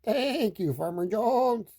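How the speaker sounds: high bleating sheep; high wobbly sheep speech. A narrator's reading. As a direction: quaver it like a grateful sheep. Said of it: "Says the sheep".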